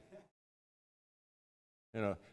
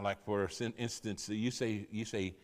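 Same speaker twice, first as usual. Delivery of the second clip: ordinary talk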